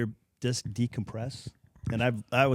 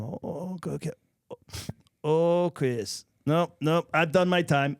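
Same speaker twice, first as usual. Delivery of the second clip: a hint of the old master waving off diapers; coach; 3 semitones higher, slower than usual. Coach voiced holding baby.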